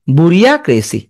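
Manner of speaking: বুরিয়াক্রেসি with a drawn "bu-ri-YA"; memory-aid pronunciation. Shown as a demonstration. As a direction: say every written vowel in বুরিয়াক্রেসি. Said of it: "এমনি উচ্চারণ করবে". (বানান ধরে বুরিয়াক্রেসি উচ্চারণ)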